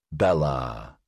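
low, drawn-out purr. A narrator's reading. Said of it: "Axford purred".

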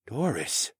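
soft stunned whisper of "Doris". A narrator's reading. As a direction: breathe "Doris?" barely aloud. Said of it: "Saul whispered".

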